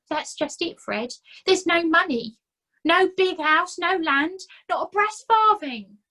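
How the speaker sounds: self-pitying complaint; whining Nobby. A narrator's reading. Nobby complaining to Fred about his bad luck, whining.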